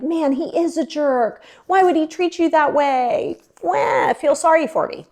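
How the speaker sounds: high, drawn-out mock pity; mock sympathetic whining. Friends reassuring the wronged client, mock pitying whine. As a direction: exaggerate the sympathy into a slow wail. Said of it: "I wanted that reassurance from everybody".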